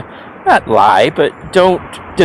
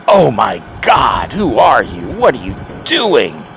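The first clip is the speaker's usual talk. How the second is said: mock scoffing; 2 semitones lower than usual. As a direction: imitate scandalized scoffers, stretching the key words. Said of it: "they would scoff".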